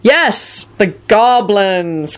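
high start, then slow emphasis; fierce Kingsley. A narrator's reading. Kingsley seizes on Luna's goblin remark, fiercely. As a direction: agree forcefully, stretching the words. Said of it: "Kingsley replied fiercely".

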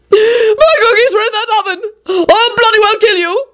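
high-pitched, tearful outrage; girlish shriek. A narrator's reading. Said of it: "shrieked like a girl".

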